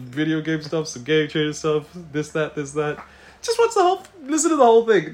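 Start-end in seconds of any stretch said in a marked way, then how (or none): 3.4-5.1 high, loud excited urging. Real, not acted. Urging listeners, laughing.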